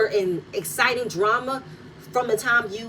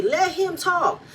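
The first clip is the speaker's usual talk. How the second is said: quoting Nell; 8 semitones higher, slower than usual. Retold or imitated. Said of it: "you hear Nell say".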